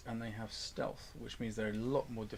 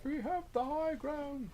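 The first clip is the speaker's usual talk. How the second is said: high, slow quoting voice; movie quote voice. Acted. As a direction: deliver it like a dramatic film line. Answers with a film quote.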